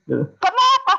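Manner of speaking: ordinary talk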